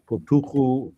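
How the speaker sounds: "patuchu" ending in an oo sound; variant Syriac pronunciation. Shown as a demonstration. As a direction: say "patuchu" with oo in the last two syllables. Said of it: "depending how you pronounce Syriac".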